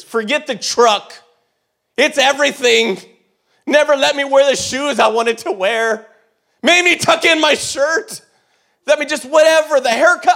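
loud, high furious list of grievances; ranting grievances. Retold or imitated. Teen ranting about years of dad's rules, loud and high. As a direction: pile on the complaints, rising in outrage. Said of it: "and I was furious".

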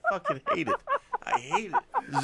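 laughing hard, high-pitched; losing it laughing through the words; cracking up mid-line. Real, not acted. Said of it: "I'm laughing".

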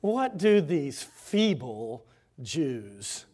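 very slow, drawn-out contemptuous sneer; scornful sneer. Acted. Sanballat jeering before the army, slow scornful. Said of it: "and said".

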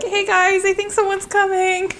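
Mabel's voice. Acted. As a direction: pitch way up as timid Mabel. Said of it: "Mabel would be like".

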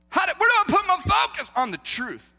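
high, loud rhetorical demand; impassioned preaching shout. Real, not acted. Preacher asking where to focus, impassioned shout. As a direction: cry it out, then ease down slightly.

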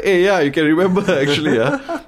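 mock-surprised greeting, laughing through it; amused delight. Retelling delighted reunion, laugh heard.

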